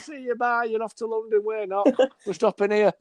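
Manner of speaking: high-pitched goodbye to passing passengers; mock farewell. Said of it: "you get passengers waving, you're like".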